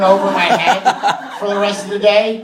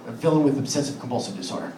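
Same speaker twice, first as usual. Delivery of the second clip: low, soft, quickened restatement; hushed title repeat; 6 semitones lower, 9 dB quieter, faster than usual. Real, not acted. Comic restates the villain bit before performing it, hushed. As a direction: repeat it quickly under your voice.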